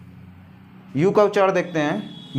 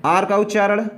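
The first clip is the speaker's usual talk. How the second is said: ordinary talk, nothing marked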